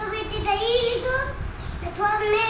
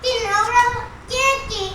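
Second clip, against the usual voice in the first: heated bickering; 9 dB louder than usual. Real, not acted. Mother and son bicker about bathing.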